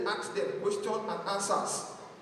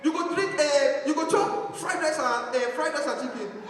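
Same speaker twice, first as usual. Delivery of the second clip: high, slow coaxing mother; coaxing mama; 4 semitones higher, 4 dB louder than usual. Retold or imitated. Mama tempting her sick son, high and coaxing. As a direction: coax him with food.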